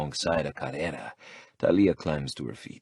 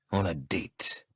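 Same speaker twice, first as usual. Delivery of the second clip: growl; 4 dB quieter, slower than usual. Acted. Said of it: "I growl".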